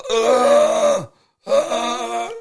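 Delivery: strained crying zombie moan; zombie groan. Pretend. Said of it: "That's quite a good zombie sound".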